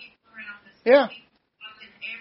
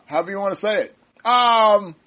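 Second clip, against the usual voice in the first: ordinary talk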